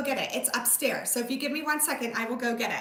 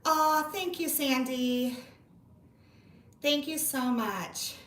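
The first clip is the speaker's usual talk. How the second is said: normal talking voice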